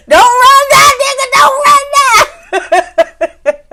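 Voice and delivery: laughing shout. Retold or imitated. Reviewer yelling at Marsau on screen, laughing shout. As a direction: yell it through laughter, egging on a fight. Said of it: "I was like".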